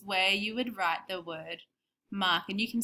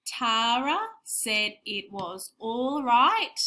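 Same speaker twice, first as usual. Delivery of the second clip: very slow, evenly paced reading; slow dictation; slower than usual. Shown as a demonstration. Co-teacher re-reading the dictation sentence, very slow. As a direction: stretch the sentence out for writing. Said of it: "should we say it again".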